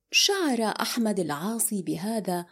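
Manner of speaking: ordinary delivery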